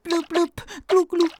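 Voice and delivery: tremble out the words; trembling. Acted. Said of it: "tout tremblotant". (petite voix tremblotante)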